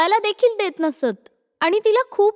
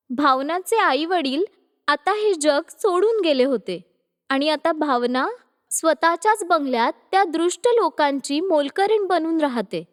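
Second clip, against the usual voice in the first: usual speaking voice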